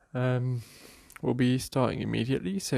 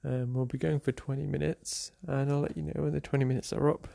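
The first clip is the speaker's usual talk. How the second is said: ordinary talk, nothing marked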